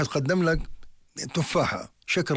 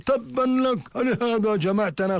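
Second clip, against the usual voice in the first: much higher, indignant mock rebuke; scornful retort; 8 semitones higher, slower than usual. Quraysh scorn the Prophet for gathering them, a high indignant retort. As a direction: throw it back contemptuously. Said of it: "قالت قريش".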